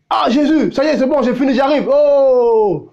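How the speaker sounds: eager believer. Acted. Dying believer greets Jesus eagerly.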